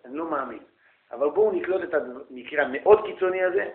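usual speaking voice

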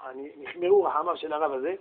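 usual speaking voice

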